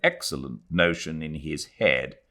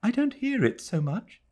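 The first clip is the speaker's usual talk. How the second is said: put-on Adela comforting the old woman; gentle young woman. A narrator's reading. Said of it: "said Adela".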